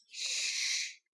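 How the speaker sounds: unvoiced shush; a soft warning shush =44; hushing shh. A narrator's reading. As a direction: breathe a quick hush. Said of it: "said Tom".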